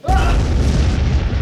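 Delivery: loud high battle cry; power-up yell. Acted. Showing off plunger superpowers.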